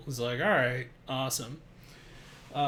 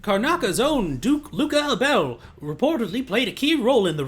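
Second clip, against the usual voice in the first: put-on higher, louder old-time newsreel announcer; 1930s radio voice; 5 semitones higher, 3 dB louder than usual. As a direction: read it like a vintage radio bulletin. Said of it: "Is it time for nineteen thirties radio voice again?".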